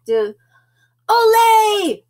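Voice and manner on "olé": high, loud celebratory cheer; big ringing storybook shout. A narrator's reading. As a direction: shout it like a crowd of amigos. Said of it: "Shouted his amigos".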